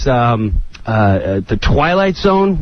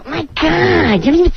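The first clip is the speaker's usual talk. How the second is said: higher, exasperated co-star; hammed-up annoyed Katan; mock Katan whine; 9 semitones higher than usual. Katan griping at Norm in rehearsal, higher and exasperated. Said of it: "Katan would go".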